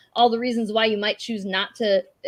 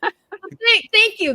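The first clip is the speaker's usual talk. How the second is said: excited thanks; 11 semitones higher, 7 dB louder, much slower than usual. Real, not acted. Relieved when the lost word is supplied.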